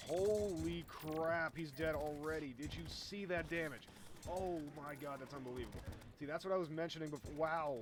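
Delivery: amazed outburst. Real, not acted. Gamer seeing the boss die from huge damage, amazed outburst. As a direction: exclaim in real disbelief, loudly.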